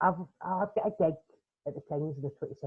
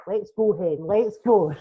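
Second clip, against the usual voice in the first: much louder, higher, keen to go; eager mum; 5 semitones higher, 11 dB louder, slower than usual. Retold or imitated. Mum rushing her daughter to leave, loud and eager. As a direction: hurry everyone along with glee.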